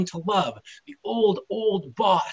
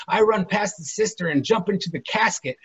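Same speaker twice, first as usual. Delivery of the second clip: ordinary delivery, nothing marked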